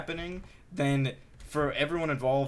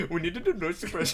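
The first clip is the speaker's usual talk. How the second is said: higher, faster exaggerated Indian accent; 4 semitones higher, faster than usual. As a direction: imitate his Indian accent. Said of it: "Does he have an Indian accent?".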